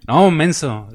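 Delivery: loud teasing scold; mock rebuke. Preacher jokingly scolding the congregation, loud teasing. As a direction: tease them loudly for the joke.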